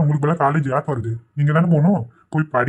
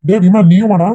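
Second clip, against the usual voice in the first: higher and louder call; 4 semitones higher, 8 dB louder than usual. Acted. Father calling out to his younger son, loud. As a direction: call the boy over.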